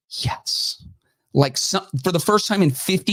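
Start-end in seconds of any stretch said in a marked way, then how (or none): 0.1-0.8 breathless excited whisper; whispered yes. Retold or imitated. C-SPAN staff whispering yes over the drama, hushed. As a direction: hiss a secret little yes of glee. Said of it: "they had to have been like".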